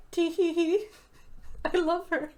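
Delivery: high, bubbly giggling voice; tee-hee it up high and giddy; giggly character voice. Acted. Café owner giggling sweetly about a regular, high and giddy.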